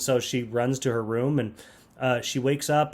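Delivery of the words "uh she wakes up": ordinary talk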